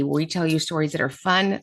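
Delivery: usual speaking voice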